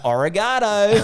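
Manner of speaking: hammed-up Aussie twang on a foreign word; exaggerated Aussie accent. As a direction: lay the Aussie accent on thick, laughing. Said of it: "put on the Aussie accent further".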